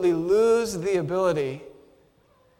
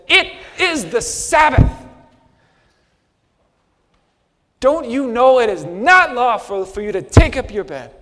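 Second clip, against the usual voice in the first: stern religious authority; 4 semitones higher, 8 dB louder than usual. Retold or imitated. Religious leader scolding the healed man, stern authoritative voice. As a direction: play the solemn official scolding. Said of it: "his most authoritative voice".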